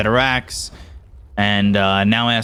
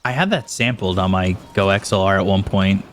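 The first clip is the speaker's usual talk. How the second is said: ordinary talk, nothing marked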